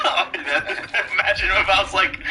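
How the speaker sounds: talking through laughter; giggling while setting up the joke; laughing. Real, not acted.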